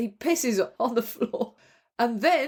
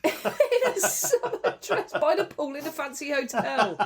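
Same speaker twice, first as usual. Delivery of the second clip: higher voice, giggling through the words; laughing; 6 semitones higher than usual. Real, not acted. Co-host echoing the absurd detail of the story, laughing. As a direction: barely get it out for laughing.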